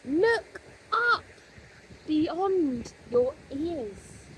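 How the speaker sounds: high, drawn-out voice from somewhere else; mysterious voice. A narrator's reading. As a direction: call to him from far above, slowly. Mysterious voice speaks to Fox.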